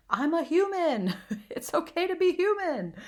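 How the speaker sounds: real laugh while admitting she's human. Real, not acted. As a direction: giggle through the words. Laughs at herself.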